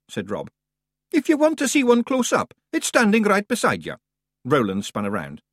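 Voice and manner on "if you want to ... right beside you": brisk, higher Scots brogue for the wee man; Scottish Feegle voice. A narrator's reading. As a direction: play the cocky little Scot. Rob Anybody voiced in Scots.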